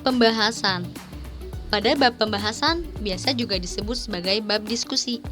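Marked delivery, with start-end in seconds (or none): none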